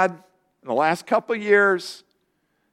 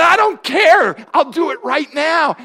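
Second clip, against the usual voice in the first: loud eager shout; 9 semitones higher, 6 dB louder than usual. Retold or imitated. Pastor retelling begging the nurse for the shot, loud and high. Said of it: "I said".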